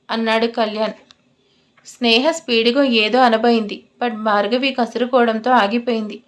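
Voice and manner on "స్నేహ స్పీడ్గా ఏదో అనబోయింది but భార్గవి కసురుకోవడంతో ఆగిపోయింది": usual speaking voice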